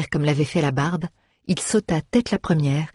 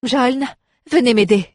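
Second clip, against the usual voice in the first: higher, louder urgent call; calling for help; 8 semitones higher, 4 dB louder than usual. A narrator's reading. Bard holding the door calls the dwarf, urgent call. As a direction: call a companion over to help.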